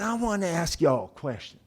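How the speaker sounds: badly faked Southern officer; bad Southern accent. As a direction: announce a question in a mock Southern accent. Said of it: "worst impression of a Southern accent".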